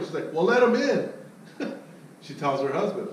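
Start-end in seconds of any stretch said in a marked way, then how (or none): 0.3-1.1 higher and louder, breezy command; wife in charge. Retold or imitated. Wife telling her husband to let him in, breezy. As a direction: she settles it with one casual order.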